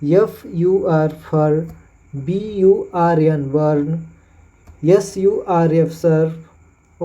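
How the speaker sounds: slow spelling, then फर, burn, Surf; slow spelling demo. Shown as a demonstration. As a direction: model slowly. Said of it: "उसी प्रकार है यूआर". (धीमे फर burn Surf)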